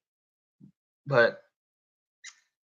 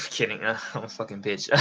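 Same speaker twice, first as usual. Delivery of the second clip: ordinary delivery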